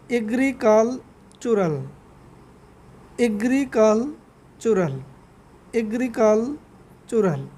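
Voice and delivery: Agricultural said slowly four times; slow pronunciation drill. Shown as a demonstration. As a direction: slowly repeat agricultural for students. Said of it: "মূলত উচ্চারণ হবে agricultural". (ধীরে ধীরে agricultural বারবার উচ্চারণ)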